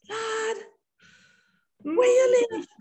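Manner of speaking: very high, loud patois question; eager Jamaican kid. Retold or imitated. Schoolkids asking the new girl where she lives, excited. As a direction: ask with excited curiosity. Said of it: "the kids were like".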